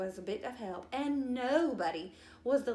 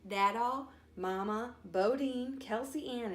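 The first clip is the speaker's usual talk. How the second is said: usual speaking voice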